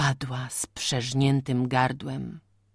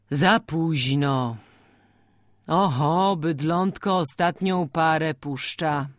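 solemn; slower than usual. A narrator's reading. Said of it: "rzekł uroczyście Ambroży".

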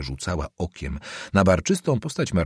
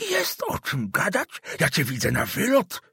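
put-on higher, vehement old man swearing an oath; old councillor denouncing the student, higher and vehement; 6 semitones higher than usual. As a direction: denounce the boy to the family.

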